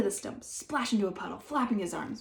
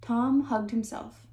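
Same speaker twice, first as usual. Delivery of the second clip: ordinary delivery, nothing marked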